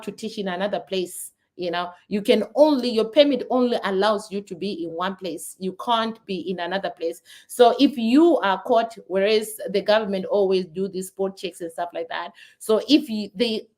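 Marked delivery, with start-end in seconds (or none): none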